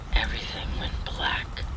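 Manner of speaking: plain reading voice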